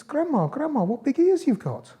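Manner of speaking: put-on very high, slow little-girl wonder; high, slow storybook girl; Little Red Rosenberg. A narrator's reading. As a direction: draw it out high as an amazed girl. Girl marvels at disguised wolf.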